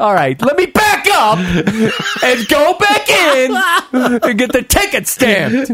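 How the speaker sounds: high, slow, laughing, half-shouted. Real, not acted. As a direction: laugh through the words in defeat. Retelling defeat, laughing.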